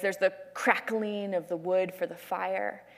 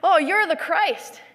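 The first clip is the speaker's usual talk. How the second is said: higher-pitched offhand exclamation, settling down at the end; casual, lifted delivery, falling away; nonchalant; 6 semitones higher, 4 dB louder than usual. Acted. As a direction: toss it off as an acted Peter. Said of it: "and so sort of nonchalantly".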